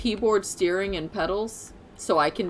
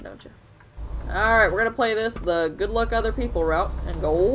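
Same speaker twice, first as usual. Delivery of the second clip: ordinary delivery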